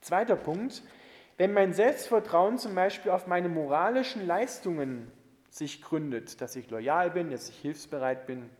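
usual speaking voice

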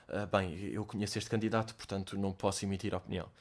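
low, soft, mock-formal judge; imitating a judge. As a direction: lightly play a stiff judge recusing himself. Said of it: "ele ia dizer". (imitar um jurado, baixo e formal)

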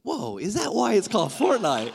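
mock sudden realisation; amazed listener. Preacher mimics listeners learning what fortnight means, amazed. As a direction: act out a light "whoa" of discovery. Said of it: "Some of you are like".

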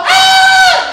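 very high, loud yell; army's scream. Acted. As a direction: burst into a panicked-army scream. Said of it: "And they all".

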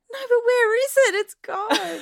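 mock-distraught demand to know; high-pitched retold dismay; dismayed protest. Retelling dismay after delight.

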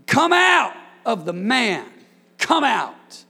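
slow, loud command to the spirit; commanding shout. Acted. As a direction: bark the demon out. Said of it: "you get excited when you talk like that".

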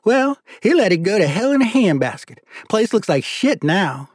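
high Southern character voice; high voice, drawing out the complaint; disgusted judge. Acted. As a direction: grumble as a folksy judge, pitched well up.

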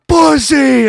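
high, loud single-word taunt; mock shouted insult. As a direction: yell it in his face. [name] yelling in front of girlfriend.

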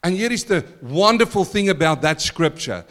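normal talking voice

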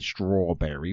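a clumsy stab at an American "Strawberry"; American accent. Retold or imitated. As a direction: Englishman faking an American "Strawberry" at the drive-through. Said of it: "put on an American accent and said".

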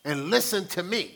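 loud, pushy mock know-it-all; self-righteous. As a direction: lecture like you're always right. Mock self-righteous lecture to partner.

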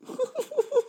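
squeaky high laugh. Real, not acted. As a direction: let out a high giggle. Laughs at own joke.

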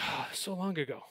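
soft, sighing wistfulness; softly nostalgic, lightly put on; mock wistful. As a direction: play the crowd sighing over old memories. Crowd's mock nostalgia for old film.

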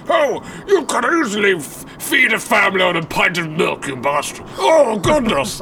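high, blustering politician voice; blustery pompous mayor. Retold or imitated. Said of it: "I could be a blustering politician".

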